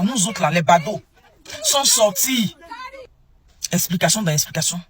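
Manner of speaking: usual speaking voice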